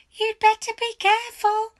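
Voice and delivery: high, loud nagging hag voice; shrill old wife. A narrator's reading. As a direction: snap at him like a suspicious old hag. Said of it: "Missus Twit said".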